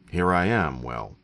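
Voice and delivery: ordinary delivery, nothing marked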